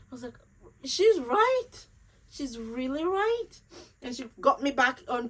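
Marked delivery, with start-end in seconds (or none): 0.8-3.5 much higher and louder, then settling; sudden realization. Retold or imitated. Admitting to herself her friend is right, sudden and higher. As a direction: blurt out the realization.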